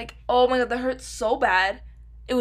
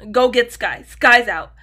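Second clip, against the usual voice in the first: louder imitation of mom's alarm; mom's urgent call; 11 dB louder than usual. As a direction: bark it like mom raising the alarm. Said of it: "Two, two is Sky".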